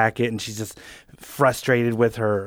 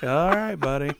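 softer, higher soothing aside; placating; 4 semitones higher, 6 dB quieter, slower than usual. Retold or imitated. Comic to yelling bartender, soft placating. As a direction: gently talk down someone rowdy.

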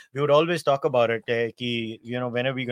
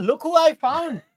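excited; 6 dB louder, slower than usual. Real, not acted. Host introducing his guest.

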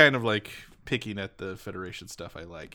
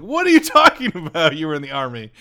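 loud, high-pitched mock outburst; astonished demand; 12 semitones higher, 14 dB louder, slower than usual. Viewer learning Bob Ross was in the army, astonished demand. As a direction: shout it incredulously, breaking into laughter.

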